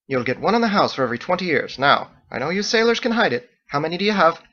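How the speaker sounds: high, bright-toned Irish-style hostess; Margot's lilting voice. A narrator's reading. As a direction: play the chatty innkeeper woman, high.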